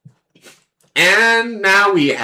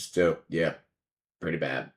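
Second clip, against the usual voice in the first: muttered aside; 12 dB quieter than usual. Real, not acted. Muttering about his weak card.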